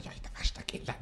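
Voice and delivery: soft whispered confession; whispered aside. Retold or imitated. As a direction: whisper it under your breath.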